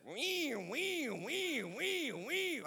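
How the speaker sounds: high repeated wee-wee squeal; mock machine whine; imitated car noise wee. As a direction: squeal like a broken engine. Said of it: "it sounds like this".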